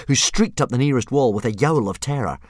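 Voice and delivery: ordinary delivery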